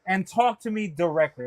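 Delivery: normal talking voice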